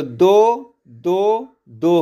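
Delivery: दो दो दो drawn out slowly, one beat each; slow meter count. Shown as a demonstration. Teacher slowly counting the meter of प्रकृति. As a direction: count दो दो दो deliberately. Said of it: "प्रकृति लिखेंगे तो".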